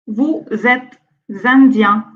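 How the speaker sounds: usual speaking voice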